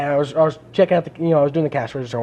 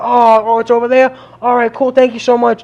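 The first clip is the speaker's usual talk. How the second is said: imitating the caller; 6 semitones higher, 6 dB louder than usual. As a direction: play the phone owner pitched up high. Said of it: "They go".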